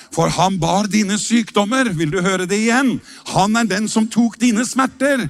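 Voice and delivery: sing-song preacher's declaration; fervent preaching. Real, not acted. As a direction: preach it out over the music. Preaching at full force.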